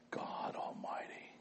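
whispered, scandalized; whispered God Almighty; hushed whisper. Retold or imitated. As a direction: breathe it out in shock. Retelling shocked hearer's complaint.

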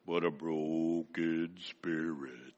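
low, slow, put-on solemn voice; mock-solemn little girl's low voice; changed child voice. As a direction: drop the voice deep and drag it out like a three-year-old playing grave. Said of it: "change her voice".

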